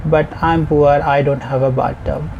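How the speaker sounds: normal talking voice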